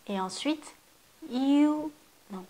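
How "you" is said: « you » haut et fort; « you » appuyé, séparé; you détaché. Shown as a demonstration. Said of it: "il faut d'abord prononcer ti". (deuxième partie de jiu)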